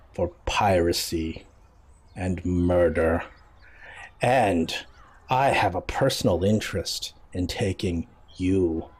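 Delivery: low, slow, measured threat; calm menacing admiral. Acted. Admiral warning the pirate of prison, low and calmly menacing. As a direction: drop low and space the phrases out coolly. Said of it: "now he looks really calm".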